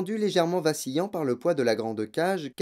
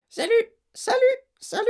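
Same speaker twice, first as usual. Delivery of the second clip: very high, squeaky parrot voice; squawking parrot; 4 dB louder, faster than usual. A narrator's reading. Parrot suddenly greeting Matilda and Fred, squawking. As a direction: cry out like a parrot. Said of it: "lança de nouveau la voix".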